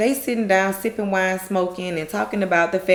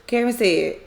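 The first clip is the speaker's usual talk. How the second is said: ordinary delivery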